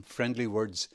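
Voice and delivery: ordinary delivery, nothing marked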